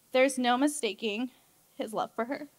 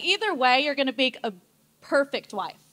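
ordinary talk

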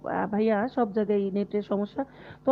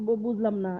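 normal talking voice

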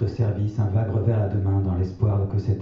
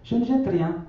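grand-mère, voix bien plus aiguë et douce; 12 semitones higher than usual. A narrator's reading. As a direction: prendre la voix haute de la vieille grand-mère. (grand-mère qui répète sa maxime aux enfants, voix aiguë)

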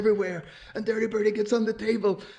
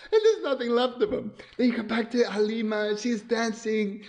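ordinary delivery

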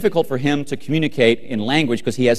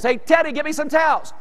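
very high strained-up call; mock yelling upstairs; 13 semitones higher than usual. Wet husband calling wife for towels, high mock yell. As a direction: holler it to another floor, retold for laughs. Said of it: "I yell upstairs".

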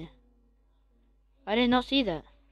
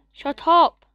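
loud yell, higher; louder shout at dog; 5 semitones higher, 9 dB louder than usual. Real, not acted. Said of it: "to the dog".

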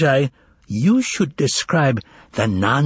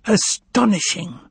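higher, slow astonished exclamation; exclaiming; 8 semitones higher than usual. Acted. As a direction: marvel aloud in a foreign accent. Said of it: "exclaimed the uninvited discussant".

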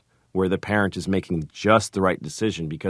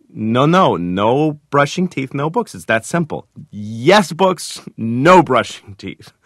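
loud, higher, emphatic re-enactment; firm parent; 6 semitones higher, 6 dB louder, slower than usual. Retold or imitated. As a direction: lay down the rule to a stubborn child. Retelling his standoff with daughter.

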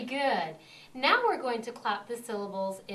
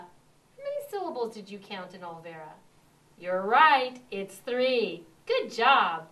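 ordinary delivery

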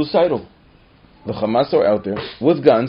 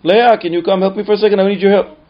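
higher, louder, faster call; mother calling; 8 semitones higher, 8 dB louder, faster than usual. Retold or imitated. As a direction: ask a daughter for help. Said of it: "Half an hour later".

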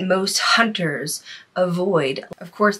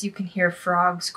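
ordinary talk, nothing marked

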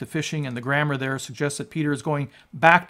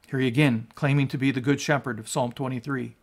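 ordinary talk, nothing marked

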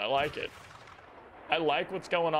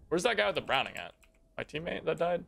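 usual speaking voice

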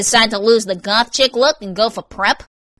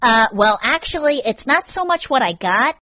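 tag; 7 semitones higher, 5 dB louder than usual. Acted. Evasive, awkward confession.

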